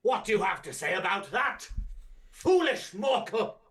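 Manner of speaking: booming villain proclaiming from a throne; booming demon lord. Acted. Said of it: "presence of Doctor Chaotica, ruler of the cosmos".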